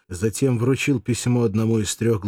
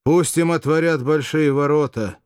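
commanding; 6 semitones higher, 4 dB louder than usual. Acted. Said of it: "приказал своим людям".

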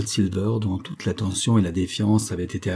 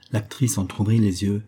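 usual speaking voice

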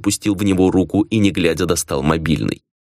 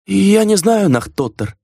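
high, scared servant voice; a cowed servant answers meekly; timid servant; 11 semitones higher, slower than usual. A narrator's reading. Servant answers the angry master.

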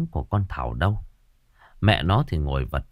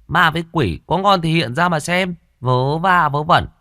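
high, louder defiant taunt; 7 semitones higher, 3 dB louder than usual. A narrator's reading. The girl mocks the ghosts from the grave, high and defiant. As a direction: dare the ghosts to show themselves. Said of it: "Thảo lên giọng".

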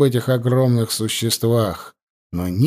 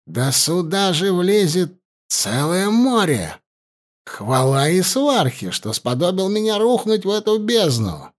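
excited; 7 semitones higher than usual. Acted. Governor marvels at the cavern.

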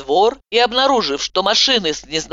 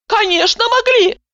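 wailing yell. A narrator's reading. Patient wails back at the doctor. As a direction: wail it with fresh fervour. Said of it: "завопила я с новым пылом".

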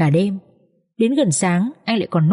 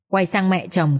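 usual speaking voice

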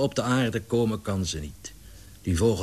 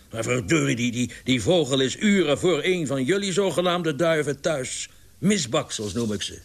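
higher, stammering indignation; roaring outrage; 7 semitones higher than usual. A narrator's reading. Larry insulting the club's so-called pigeons, indignant. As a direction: sputter angrily in defence of the bird. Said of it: "brulde Larry".

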